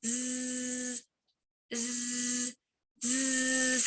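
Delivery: long soft buzzing zzz; buzzing z sound. Shown as a demonstration. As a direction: buzz like a bumblebee through teeth. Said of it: "a kind of a bumblebee sound".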